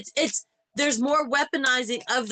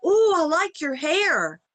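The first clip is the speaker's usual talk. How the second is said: higher, drawn-out imitation of gushing; mock gushing compliment; 5 semitones higher, 3 dB louder, slower than usual. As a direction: coo it like an admiring stranger. Quoting an admiring stranger.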